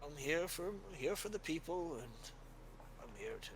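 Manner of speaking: put-on soft, high old cleric sending someone away; a kindly elder waving off a guard; gentle old priest. Father dismissing Ulfrig gently, softly and kindly.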